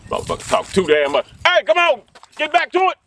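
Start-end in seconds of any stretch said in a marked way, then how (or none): 1.5-3.0 high, loud coach shout; coach yell. Acted. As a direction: get the team moving. Coach yelling at team.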